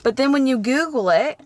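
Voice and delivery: southern twang slipping out, pitched high and loud; southern accent. Real, not acted. Telling viewers what a search shows, southern accent. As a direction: let a southern accent slip out, lifted and emphatic. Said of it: "my southern accent came out".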